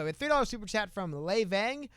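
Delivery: normal talking voice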